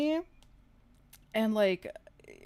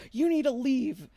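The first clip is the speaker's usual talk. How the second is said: higher, louder imitated order; mock angry demand; 5 semitones higher, 6 dB louder than usual. Stranger orders her out of the world, mock angry. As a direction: imitate him insisting, punched and forceful.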